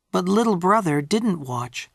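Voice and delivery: plain reading voice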